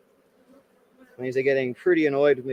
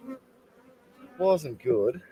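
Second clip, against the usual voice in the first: "It wasn't good" dragged out very slowly; slow, drawn out; 5 dB quieter, much slower than usual. Real, not acted.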